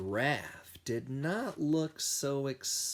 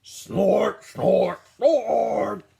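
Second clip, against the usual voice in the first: loud snorting hippo, rising; hippo snorts; 9 semitones higher, 11 dB louder, much slower than usual. A narrator's reading. Hippo snorting loudly at the zoo. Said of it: "the hippo went snort".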